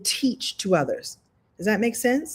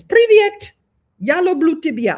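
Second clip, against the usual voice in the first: Privyet said high and louder in a mock Russian accent; Russian phrases; 9 semitones higher, 7 dB louder than usual. Greeting Russians in their own language, mock Russian accent. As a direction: greet them in hammed-up Russian.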